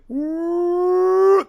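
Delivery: sudden high siren wail. Retold or imitated. Telling his friend how the siren suddenly went off, high wail. Siren starts mid-story.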